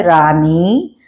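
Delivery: ordinary delivery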